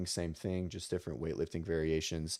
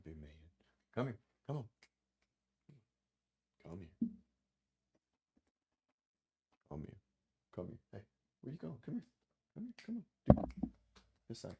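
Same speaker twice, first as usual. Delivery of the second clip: soft, cooing dog-talk voice, much softer; 9 dB quieter than usual. Real, not acted. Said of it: "This is how I talk to Bennett".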